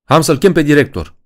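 high, loud alarmed yell. Acted. Helen jumps up in the dark cinema, shouting in panic. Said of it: "urlă ea sărind în picioare".

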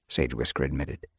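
ordinary delivery

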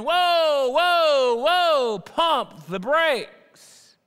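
loud, high alarmed "whoa, whoa, whoa", then slowing right down on "pump the brakes"; a loud, high startled halt that drags out the last words slowly. Acted. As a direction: yell like someone slamming on the brakes. Nicodemus startled by Jesus' words.

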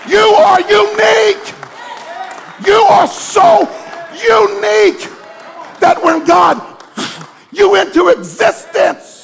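fiery pulpit shouting; preaching at full force. Acted. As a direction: build the congregation to a roar.

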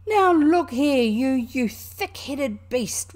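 loud, high outburst, slowing on the insult; furious, drawn-out scolding; really angry. A narrator's reading. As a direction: explode at an obtuse friend. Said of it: "really angry".

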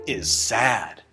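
normal talking voice

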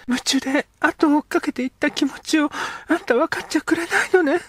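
put-on a girl pouring out how she chased after him; young girl. Girl character speaks.

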